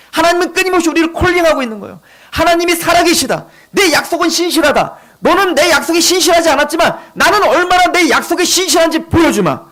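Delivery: high, driving sermon voice; fervent preaching. Real, not acted. Preacher tells congregation how God pursues them, fervent preaching. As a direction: press each point on the congregation, pitched high.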